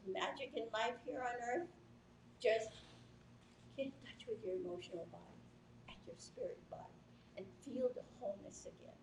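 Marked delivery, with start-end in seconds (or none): 3.8-9.0 drops to a near whisper, fast then soft; soft spiritual whisper; hushed. Real, not acted. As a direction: hush it down like a secret.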